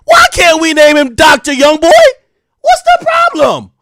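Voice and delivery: outraged, yelling at the unfairness; indignant shout. Real, not acted. Ranting to viewers about [name], shouting indignantly. Indignant rant defending [name].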